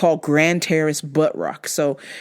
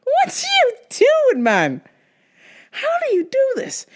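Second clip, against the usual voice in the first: mock disbelief; 12 semitones higher than usual. Retelling her reaction to the coworker, in high mock disbelief. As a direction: act stunned at him, voice jumping up. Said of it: "And I'm just like".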